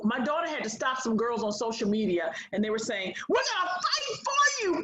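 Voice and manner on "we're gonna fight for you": very high-pitched eager chant; imitated rallying cry. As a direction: mimic overeager would-be rescuers, pitched way up. Voices girls rallying on social media.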